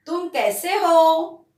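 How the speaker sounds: normal talking voice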